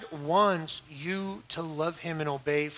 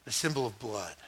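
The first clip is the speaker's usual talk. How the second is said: low, soft explanation; hushed solemn answer; 6 semitones lower, 8 dB quieter than usual. Acted. Believer explaining the sign to a stranger, low and solemn. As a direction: say it low and quietly, with weight.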